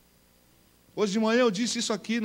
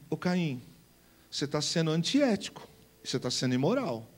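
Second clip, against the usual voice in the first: soft manner; 5 semitones lower, 5 dB quieter than usual. Acted. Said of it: "uma maneira suave".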